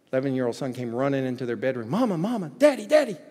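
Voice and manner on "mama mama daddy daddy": put-on higher, louder child cry of alarm; excited child calling. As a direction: call out like a frantic kid. Child running in on Christmas.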